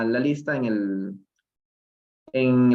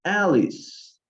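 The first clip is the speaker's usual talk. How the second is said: calling out; 6 semitones higher than usual. A narrator's reading. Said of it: "he called out loudly".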